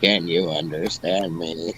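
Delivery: imitating a robotic echo. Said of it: "but right after it in a robotic voice".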